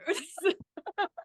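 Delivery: high-pitched breaking laugh. Real, not acted. Laughing at her ex's absurd forgiveness, high-pitched. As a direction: laugh until it cracks up high.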